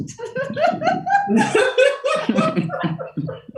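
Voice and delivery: loud, very high whinnying laugh; high horse-like laugh that falls away. Shown as a demonstration. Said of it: "that could be a horse".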